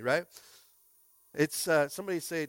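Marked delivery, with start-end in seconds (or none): none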